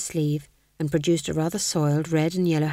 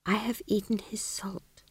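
whispered thought; 3 semitones higher, 8 dB quieter than usual. Acted. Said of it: "he thought".

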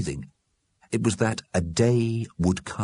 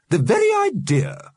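high, louder, scandalised; indignant; 5 dB louder than usual. A narrator's reading. Missus Liberty stands up to object, indignant. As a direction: voice shoots up in prim outrage.

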